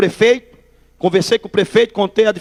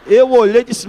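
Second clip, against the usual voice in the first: normal talking voice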